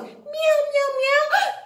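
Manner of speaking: high mock "meow, meow", like a playful cat. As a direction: mew it high for the kids like a kitten. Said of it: "a cat goes meow".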